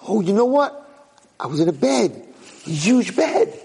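high, dawning amazement; excited realization. Acted. Prince recalling his old bed, excited realization. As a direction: let the memory burst out high. Kid's memory starts returning.

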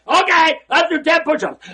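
loud, high barked command; trainer's order. Retold or imitated. Paid trainer shouting at his client, loud and high. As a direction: bark the push-up order. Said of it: "And he says".

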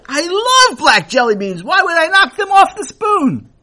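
high, loud protest; a man insisting he loves them; indignant guest. A narrator's reading.

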